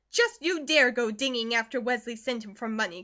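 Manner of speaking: loud warning cry; angry cry. Acted. Mother forbids borrowing from Wesley, loud cry. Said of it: "cried Missus Comstock".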